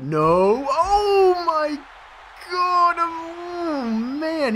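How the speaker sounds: high, drawn-out yell of disbelief; astonished outcry. Real, not acted. Player reacting to a huge hit, astonished. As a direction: wail it loud, then slide back down.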